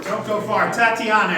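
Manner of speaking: normal talking voice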